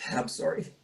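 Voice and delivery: choked, tearful apology, pitch cracking high; fighting back tears, barely getting words out; voice breaking into tears. Real, not acted. Breaks down recalling son's death.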